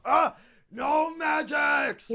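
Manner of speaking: slow, loud, high orc shout; outraged orc. Acted. Orc at the slammed door, outraged drawn-out shout. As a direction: roar a ban, stretching the words. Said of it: "The orc goes".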